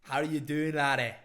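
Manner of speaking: put-on loud, blunt Scottish accent greeting; harsh Scottish accent. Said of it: "a Scottish accent".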